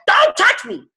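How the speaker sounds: loud, high, cranky complaining, cracking to a cry at the end; woman in labor. Retold or imitated. Coach voicing a mother in labor to her team, loud complaining. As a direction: wail out each complaint like a miserable mother in labor. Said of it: "it's like".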